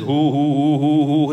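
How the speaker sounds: loud rhythmic hoo chant; hammed-up swaying hoo cries; mock dance chant. Preacher mocking ecstatic dancers in a frenzy, loud hoo calls.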